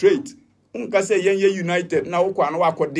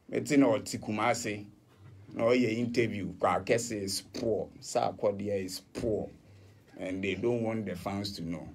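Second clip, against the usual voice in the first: hushed confiding; 7 semitones lower, 9 dB quieter than usual. Retold or imitated. Confiding what the interview revealed.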